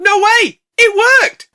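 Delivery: loud, very high delighted squeal; mock amazement. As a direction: gasp in disbelief. Surprised joy car starts.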